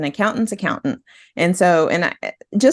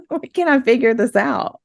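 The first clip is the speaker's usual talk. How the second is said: laughing exasperation; 6 semitones higher than usual. Real, not acted. Laughing at her own frustration.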